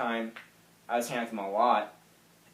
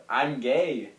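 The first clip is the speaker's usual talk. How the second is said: a retold burst, shouted louder; blurting it out in a loud yell; 3 semitones higher, 6 dB louder than usual. Jumping up to tell his best friend, a sudden loud yell. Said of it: "And I just yelled".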